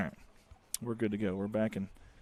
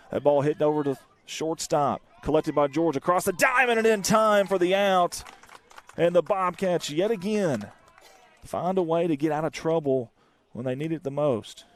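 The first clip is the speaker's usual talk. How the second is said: louder and higher, triumphant out call; excited play call; 6 semitones higher, 8 dB louder than usual. Real, not acted. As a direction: celebrate the home team escaping trouble. Inning-ending play call.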